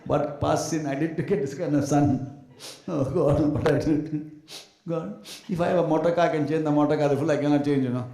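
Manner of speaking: loud, wailing complaint to God; hammed-up loud lament to God; mock lament. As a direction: parents crying to God over a bad child, loud. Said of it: "standing in front of altars ... crying".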